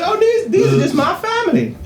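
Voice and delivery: high-pitched eccentric character; the owner's voice. Acted. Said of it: "I don't know, that's the voice".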